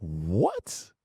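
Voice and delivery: soft, drawn-out rising question; baffled disbelief. Retold or imitated. Son hearing dad say let's pray, baffled. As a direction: let it creep up from low, mock-bewildered. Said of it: "And I'm like".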